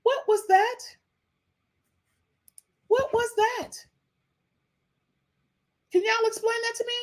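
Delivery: asking viewers, high mock innocence; mock baffled. Real, not acted. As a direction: play dumb in a squeaky voice. Mock puzzled, rising.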